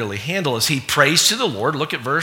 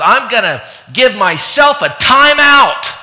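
put-on higher, louder, slow defiant little-boy declaration; pouting nephew; 9 semitones higher, 8 dB louder, slower than usual. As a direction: announce it like a stubborn little boy. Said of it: "and he said".

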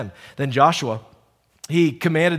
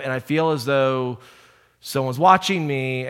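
usual speaking voice

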